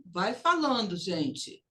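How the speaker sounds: ordinary talk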